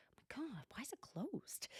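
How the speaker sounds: winded, whispered gasp of frustration; out of breath at a stuck door; breathless whisper. Retold or imitated. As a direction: push it out with no air left after the climb. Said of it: "out of breath after walking up that hill".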